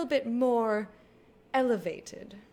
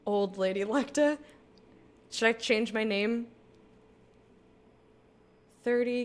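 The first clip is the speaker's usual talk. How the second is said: usual speaking voice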